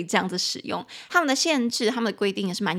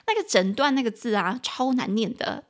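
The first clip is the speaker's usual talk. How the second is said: ordinary talk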